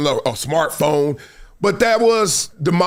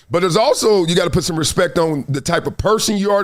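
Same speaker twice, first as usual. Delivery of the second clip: usual speaking voice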